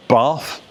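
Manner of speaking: "bath" with a long back "ah" vowel. Shown as a demonstration. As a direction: say "bath" as "bahth", RP style. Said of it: "uses lots of different phonetics".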